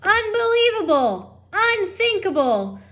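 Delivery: high, loud, outraged rant; outraged shout. Acted. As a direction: bellow it in disbelief. Lester ranting at wife.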